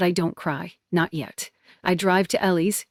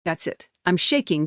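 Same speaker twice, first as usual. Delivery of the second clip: usual speaking voice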